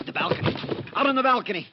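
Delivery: high, loud urgent warning; urgent alarm. Acted. Summers warning that someone is out on the balcony, alarmed. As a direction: shout the warning fast.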